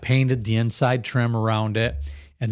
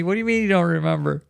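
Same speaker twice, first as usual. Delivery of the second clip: a light put-on voice, fast and higher; mock scolding family; 6 semitones higher, faster than usual. Host imagines family scolding him, higher, faster mock voice. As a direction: tease what the family will say. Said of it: "They're gonna be".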